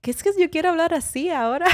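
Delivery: put-on fast, high-pitched Mexican accent. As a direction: imitate Mexican TV talk, quick and high. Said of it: "when they talk like that".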